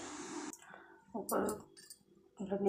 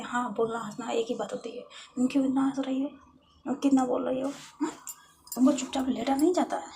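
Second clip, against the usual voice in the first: loud, raised-voice telling-off; angry scolding; 5 semitones higher, 9 dB louder, faster than usual. Acted. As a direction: scold with sharp rhetorical questions. Scolds patient for talking, laughing.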